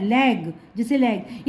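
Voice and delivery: normal talking voice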